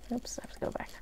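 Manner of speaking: quick unvoiced whisper to herself; whispered aside. Real, not acted. Teacher noticing her own mistake mid-lesson, whispering. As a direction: whisper it as a private correction.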